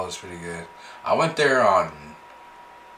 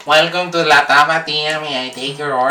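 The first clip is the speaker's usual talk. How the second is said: higher, louder counter-worker greeting; restaurant cashier voice; 4 semitones higher, 5 dB louder than usual. Pretend. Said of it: "You sound just like that".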